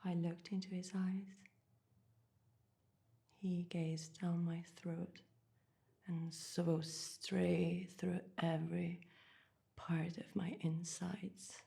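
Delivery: soft, whispery, slowing recollection; hushed intimacy. Acted. Woman recalling magician's gaze down her throat, hushed intimacy. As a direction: let it sink to an entranced hush.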